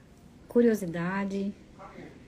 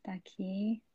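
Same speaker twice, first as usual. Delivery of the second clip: breathy aside to herself; whisper; 12 dB quieter, slower than usual. Real, not acted. Host whispering to herself while checking the live.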